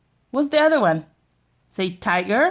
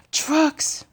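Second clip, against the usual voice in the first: gasped whisper; 6 semitones higher, 3 dB quieter than usual. Real, not acted. Mom showing the baby a truck page in a gasped whisper. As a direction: whisper trucks in a hushed gasp.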